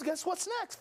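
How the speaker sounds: ordinary delivery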